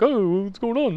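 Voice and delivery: high, nosy, drawn-in question; imitating curious dad. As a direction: play dad sidling up, all interested. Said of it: "come over with interest".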